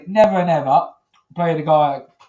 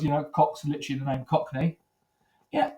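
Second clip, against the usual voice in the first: ordinary delivery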